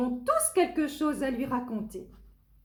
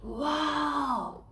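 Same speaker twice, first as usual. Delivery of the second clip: amazed wow; 5 semitones higher than usual. Acted. Wonder at fantastic world.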